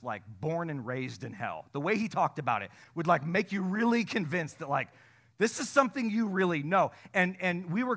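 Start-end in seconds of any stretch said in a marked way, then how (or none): none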